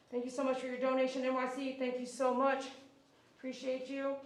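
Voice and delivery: loud, bright stream-host thank-yous; donation shout-outs. Acted. Groomer thanking livestream donors, upbeat announcer read. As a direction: hype up every donor like an announcer.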